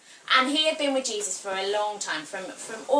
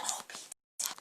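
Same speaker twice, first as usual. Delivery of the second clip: gentle Jesus; 8 semitones higher, 3 dB quieter, slower than usual. Acted. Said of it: "he says to Peter".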